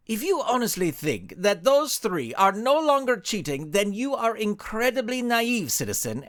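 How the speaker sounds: lightly voiced masked hero, amused and condescending; a hint of a smug superhero talking down; lightly voiced hero scoffing at a naive citizen. Alexo's voice, amused scorn.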